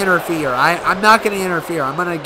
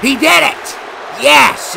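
high, loud victory yell; triumphant cheer; 9 semitones higher, 4 dB louder, slower than usual. Real, not acted. Gamer celebrating his wrestler's win with a loud cheer.

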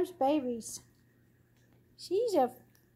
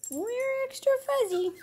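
voicing the dogs; 8 semitones higher than usual. Dogs voiced by owner.

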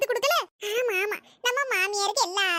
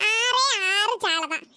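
mock lament; much slower than usual. Mock lament over six fish.

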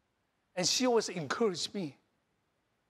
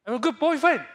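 higher and louder, proclaiming it; loud proud declaration; 8 semitones higher, 9 dB louder than usual. Shown as a demonstration. Pastor leading the congregation in affirmations, loudly proclaiming. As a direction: declare it boldly to the room. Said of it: "Talk to yourself".

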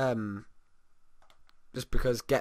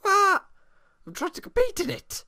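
very high, loud squeaky voice; mock indignant sim; 7 dB louder than usual. Gamer voicing a sim protesting, squeaky high outrage.

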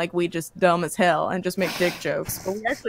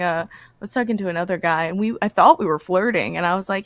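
ordinary talk, nothing marked

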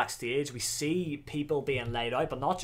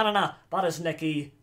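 normal talking voice

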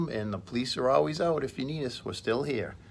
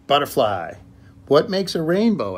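Little Rabbit asking; 5 semitones higher, 10 dB louder than usual. Acted. Little Rabbit asks Butterfly.

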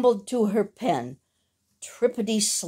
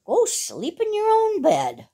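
high, bossy voice; dog chasing the cat from his kennel; scolding dog; 9 semitones higher than usual. A narrator's reading. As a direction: shoo the cat away.

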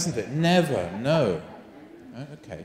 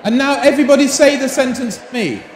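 raised teacher voice over chatter; calling out; 4 semitones higher than usual. Real, not acted. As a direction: call the class to order across the room.

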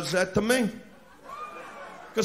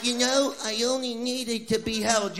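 mock hurt woman; 5 semitones higher than usual. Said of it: "they're like".

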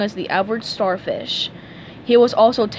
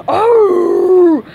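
long, very loud roar; high, drawn-out bellowing howl; 8 semitones higher, 11 dB louder than usual. A narrator's reading. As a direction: roar it out at full force. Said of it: "Patrick bellowed".

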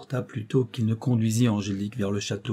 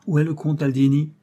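brusque; 5 semitones higher, 6 dB louder than usual. A narrator's reading. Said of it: "demanda d'un ton brusque".

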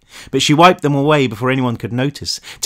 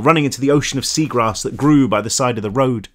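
ordinary delivery

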